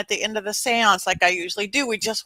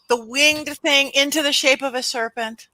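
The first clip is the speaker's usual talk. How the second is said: ordinary talk, nothing marked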